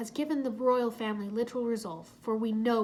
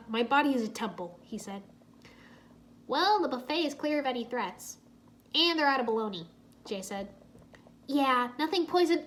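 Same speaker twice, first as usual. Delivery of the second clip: usual speaking voice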